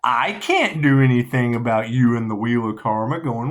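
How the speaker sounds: ordinary talk, nothing marked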